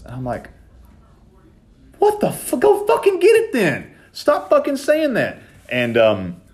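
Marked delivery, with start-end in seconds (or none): 2.0-5.4 much higher, retold outburst of irritation; imitating his own high-pitched annoyed yell; exasperated yell. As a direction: blow up at him in fed-up disbelief. Exasperated retort to brother.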